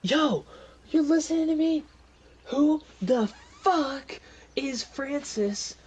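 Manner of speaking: high, loud shout with stretched words; exasperated yelling. Acted. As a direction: demand an answer from down the hallway.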